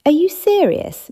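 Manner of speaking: high, loud disbelief; can't believe what you heard; incredulous. Acted. Mint reacts in disbelief.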